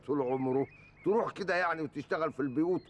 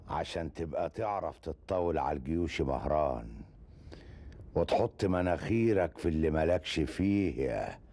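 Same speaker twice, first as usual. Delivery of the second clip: lower, slow spiteful gloat; low, slow gloating villain; gloating sneer; 8 semitones lower than usual. Acted. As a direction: savour his enemy's downfall.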